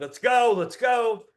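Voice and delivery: pumped up. Retold or imitated. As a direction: psych yourself up at the door. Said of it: "And I'm pumped up".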